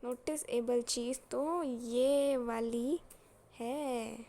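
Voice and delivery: loud, slow, stretched words; drawn-out reveal. Real, not acted. Teacher revealing the noticeable thing to students, loud and drawn-out. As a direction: drag out each phrase to hammer it home.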